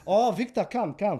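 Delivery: animated mock salesman call; eager beckoning. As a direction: usher a customer over eagerly. Shopkeeper eagerly beckoning the customer.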